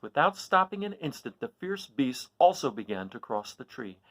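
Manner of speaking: ordinary delivery, nothing marked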